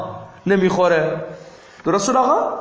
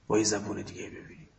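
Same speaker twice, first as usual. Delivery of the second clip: much softer, faster repeat under the breath; quiet quick echo; 8 semitones lower, 13 dB quieter, much faster than usual. Real, not acted. Teacher echoes his own phrase to students, soft and quick. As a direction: toss the repeat off softly to yourself. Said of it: "حال سوال با یه زبون دیگه ای ببینیم".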